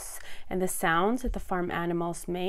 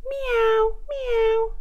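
very high, loud mewing; cat meow; 5 dB louder than usual. A narrator's reading. Storyteller as the cat calling to the spider, high meowing. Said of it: "cried the cat".

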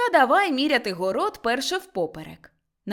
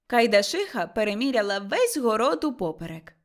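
ordinary delivery, nothing marked

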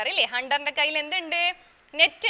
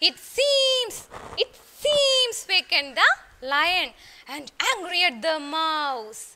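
slow, louder sing-song narration; slow story recital; 4 dB louder, much slower than usual. Shown as a demonstration. Teacher narrating the lion waking for her pupils, slow and loud. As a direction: drag the words out for the kids to copy.

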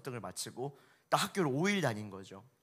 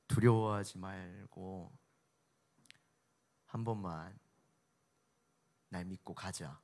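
gentle reassurance; 4 semitones lower, slower than usual. Retold or imitated. Preacher voicing Jesus to a frightened father, slow and tender. Jesus's one word to Jairus.

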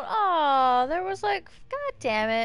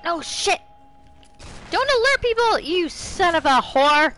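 furious yell; 10 semitones higher, 10 dB louder than usual. Real, not acted. Player yelling at careless teammate, furious high yell. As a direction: scream it at a teammate, much higher and louder.

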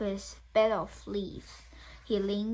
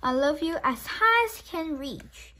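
put-on higher childlike hare; little hare voice; 7 semitones higher, 5 dB louder than usual. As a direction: reach up high with the voice.

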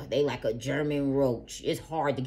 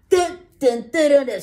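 much higher and louder, suspense sting sung out; dramatic dun dun dun; 10 semitones higher, 13 dB louder than usual. Pretend. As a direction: belt the reveal jingle like a movie twist. Dramatic reveal sound effect.

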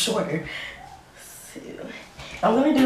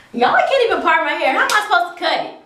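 raised, high exasperated outburst; 6 semitones higher, 7 dB louder, faster than usual. Real, not acted. Cutting own hair and stuck on the part, exasperated. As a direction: complain loudly in a higher voice.

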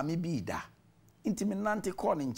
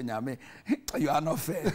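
laughing through a teasing protest; playful grumble at God, chuckling; half-laughing mock protest; laughing mock complaint.

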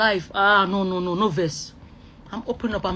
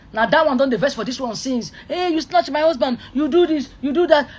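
accusing quarrel; 7 semitones higher, 7 dB louder than usual. Acted. Acted quarrel over a snatched husband.

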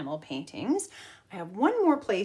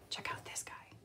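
whisper; 15 dB quieter than usual. Real, not acted. Teacher pointing at the picture, whispering. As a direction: whisper it like a secret.

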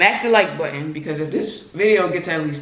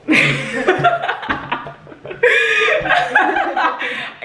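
long helpless laugh, pitched way up; high wheezing laugh. Real, not acted. Friend cracking up after the prank call, helpless high laugh. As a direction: crack up breathlessly.

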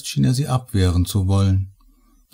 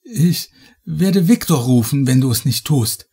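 higher-pitched, desperate threat; agitated warning; threatening; 6 semitones higher than usual. A narrator's reading. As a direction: threaten from a position of weakness. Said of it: "drohte sie".

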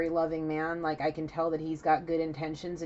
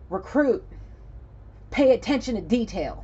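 loud, higher-pitched military order; drill instructor; 5 semitones higher, 5 dB louder than usual. Acted. Drill instructor in recruit's face, loud stern command. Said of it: "my drill instructor is in my face".

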